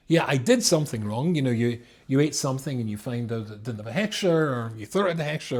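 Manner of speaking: ordinary delivery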